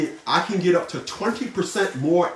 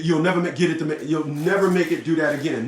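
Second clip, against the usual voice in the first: ordinary talk, nothing marked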